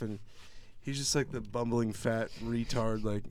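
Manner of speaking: normal talking voice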